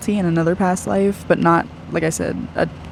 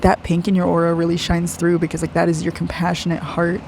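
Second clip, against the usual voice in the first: ordinary talk, nothing marked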